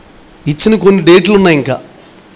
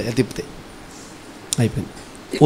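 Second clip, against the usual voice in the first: whispered; 8 semitones lower, 15 dB quieter than usual. Retold or imitated. Car steering locked story.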